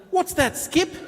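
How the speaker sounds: a hint of someone quizzing the kangaroo; Skippy parody. Presenter quotes a sparrow's name, lightly hammed-up Skippy parody.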